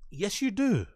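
mocking callout. Streamer calls out the lie.

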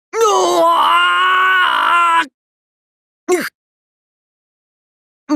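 long, high-pitched scream; drawn-out battle cry. Acted.

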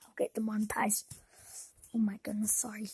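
hushed worried whisper. Real, not acted. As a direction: whisper it guiltily. Whispered worry.

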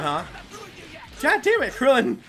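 usual speaking voice

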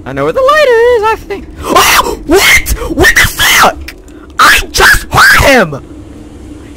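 real fear; panicked yelling. Real, not acted. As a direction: scream in alarm. Monster hears him, panics.

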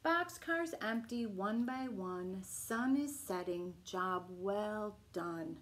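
gentle bedtime story reading; reading to children. Acted. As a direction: bedtime picture book read-aloud. Reading picture book aloud.

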